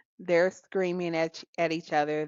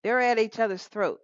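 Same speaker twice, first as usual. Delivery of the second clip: usual speaking voice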